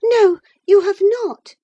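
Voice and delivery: put-on very high, loud defiant girl; high-pitched, impassioned contradiction; infatuated girl. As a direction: answer back as a lovestruck girl. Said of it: "said the infatuated girl".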